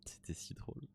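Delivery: whispered aside. Real, not acted. Player whispering to viewers, amused. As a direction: breathe it out under your breath. Quiet aside while playing.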